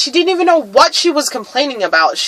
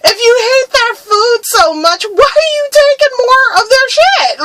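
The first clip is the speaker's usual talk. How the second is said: mock indignant shout; 7 semitones higher than usual. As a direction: shout it in laughing disbelief. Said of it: "I was like".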